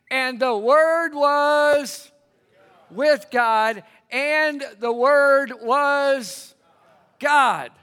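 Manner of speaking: loud, slow, drawn-out preaching; ringing call-and-response scripture; emphatic scripture recitation. Real, not acted. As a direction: hold out each phrase for the room to finish. Scripture for congregation to complete.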